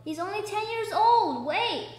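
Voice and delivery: high, loud plea; urgent protest. A narrator's reading. Aunt Daisy pleading for the ten-year-old, urgent high cry. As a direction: cry out to halt them.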